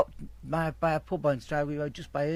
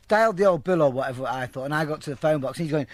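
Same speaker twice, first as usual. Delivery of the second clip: usual speaking voice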